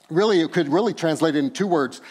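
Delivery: ordinary delivery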